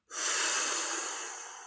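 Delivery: long breath out through the mouth; slow hissing exhale, fading out; demonstrated exhale. Shown as a demonstration. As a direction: breathe out like waves of the sea. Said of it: "breathing out through your mouth".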